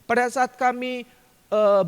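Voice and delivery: ordinary delivery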